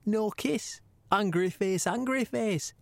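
put-on accent for the commissioner's message; Gary Shagg. Host relays the commissioner's ultimatum to the champ, in an imitated accent. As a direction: read it out in an imitated boss's accent. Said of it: "do it in my best Gary Shagg accent".

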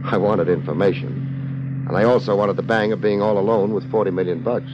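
low, dry noir voice-over; detective narration. Acted. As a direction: tell it like a tough private eye looking back. Hardboiled narration over music.

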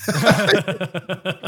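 a burst of high laughter; a light high giggle at the memory. Real, not acted. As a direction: laugh it off, amused at yourself. Laughing at being told off.

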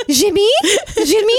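high, questioning French phone call; playful French accent, rising at the end. Pretend. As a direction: pretend to be a French caller. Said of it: "get him to call me".

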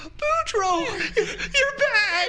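high, loud, teary cry of joy; tearful joy. Acted. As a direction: burst out with teary relief. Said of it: "tears fill into his eyes".

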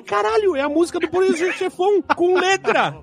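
exclamação surpresa bem aguda, recontando o susto; surpreso. Retold or imitated. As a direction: grita a descoberta como quem acaba de perceber. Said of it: "eu falei". (recontando a surpresa ao perceber a música)

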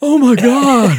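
very high, loud acted-out alarm; hammed-up shriek of shock; mock panicked cry. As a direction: cry out as the terrified friend. Friend reacts to pistol.

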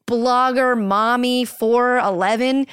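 ordinary talk

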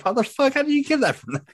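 high-pitched, incredulous squeal; baffled protest. Real, not acted. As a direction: voice climbing in sheer bafflement. Baffled at being misheard.

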